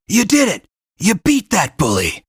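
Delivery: high, loud burst of joy; excited cheer. Acted. Guide cheering the kid for beating the bully, excited. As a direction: celebrate the win at full voice. Cheering after second boss.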